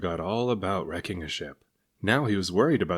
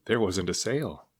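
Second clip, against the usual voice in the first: plain reading voice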